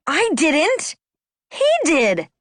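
high-pitched, indignant denial; defensive shout. A narrator's reading. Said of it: "I shouted".

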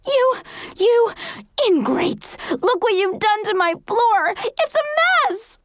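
high, outraged shriek, sputtering at first. A narrator's reading. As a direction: shriek in fury, breaking between words.

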